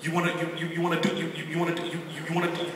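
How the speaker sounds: soft, nervous stammering repetition; imitating a stutter. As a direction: keep stalling on the same words. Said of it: "He's stuttering 'cause he's nervous".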